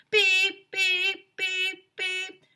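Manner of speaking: high, loud mock alarm beeps; high, even electronic beeps; imitated beeping. A narrator's reading. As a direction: beep like a smoke detector. Smoke detector keeps beeping.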